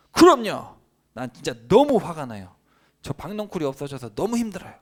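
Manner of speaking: very high, loud indignant outburst; angry Jonah retort. Retold or imitated. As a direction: snap back at God, fuming. Jonah answering God angrily. (하나님께 높고 크게 발끈하는 요나)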